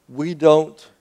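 usual speaking voice

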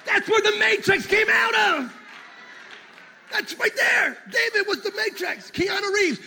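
high, excited preacher shout; excited exclaiming. Real, not acted. Preacher telling the church David was the Matrix, excited shouting. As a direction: exclaim it high like a big discovery. Excited joke called out to congregation.

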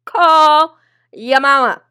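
loud, slow, stretched-out punchline; hammering the punchline home, slow and loud; slow drawn-out repeat. Retold or imitated. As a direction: draw every word out, loud and slow. Repeated punchline, slowed second take.

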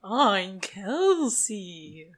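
very slow, soft eerie drawl; haunt the name out slowly; spooky voice. Acted. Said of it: "that is very spooky".

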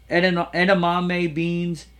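ordinary delivery

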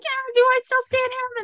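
high-pitched mock doubt; doubting self-question. Fan questioning her own loyalty to Archer, high and doubtful.

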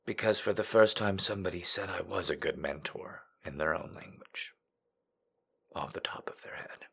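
soft moved whisper; whispered, close to tears. Real, not acted. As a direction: hush it, deeply touched. Emotional confession, whispered.

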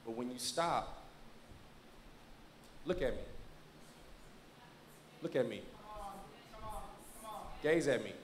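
low, soft, earnest appeal; tender reassurance. Acted. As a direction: gently hold his attention with long pauses. Tenderly reassures the man, preaching.

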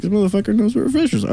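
high, fast, impressed aside; amused surprise. Retold or imitated. Speaker marvels at the homeless man's order, high and fast. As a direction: pitch up, tickled that he knows the drink. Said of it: "And I was like".

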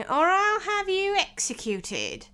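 high, slow, drawn-out threat; threatening King. Acted.

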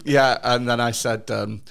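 ordinary delivery

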